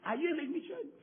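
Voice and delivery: high, loud call to the church. Real, not acted. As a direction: rouse the congregation with a shout. Preacher calls out to congregation.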